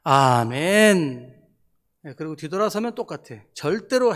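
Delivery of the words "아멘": long drawn-out lazy amen; imitating a churchgoer's hollow amen; mock amen. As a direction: stretch the amen out slowly, mocking. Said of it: "아멘만 하는 부류가 있어요".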